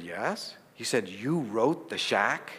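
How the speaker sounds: quiet awed disbelief; a hushed you wrote The Shack. Retold or imitated. Hushed moment of recognition.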